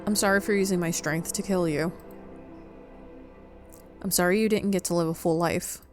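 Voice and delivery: ordinary talk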